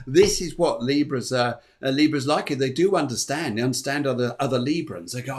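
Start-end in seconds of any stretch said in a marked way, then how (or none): none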